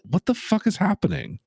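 ordinary talk

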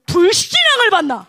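loud, imitated scolding; acted outraged accusation; mock rebuke. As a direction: burst out like a pastor rebuking someone.

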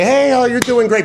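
high, chirpy fake-friendly encouragement; mock nice encourager. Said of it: "I'm gonna be nice".

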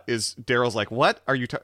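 usual speaking voice

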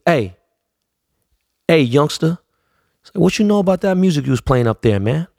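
high, lifted imitation of [name]; mock [name] calling out youngster. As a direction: mimic an older rapper greeting a kid. [name]'s voice in retold meeting.

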